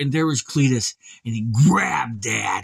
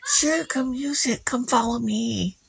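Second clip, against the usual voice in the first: put-on higher, slow coaxing old woman; sly old lady; 10 semitones higher than usual. Old lady luring Ivan away, higher and slow. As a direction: lead him on sweetly. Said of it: "She goes".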